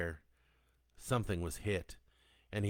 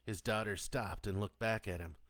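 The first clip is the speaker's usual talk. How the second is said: plain reading voice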